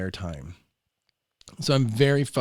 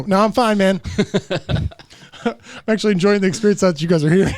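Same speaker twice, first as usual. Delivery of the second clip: laughing reply; 8 semitones higher, 11 dB louder than usual. Retold or imitated. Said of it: "I go".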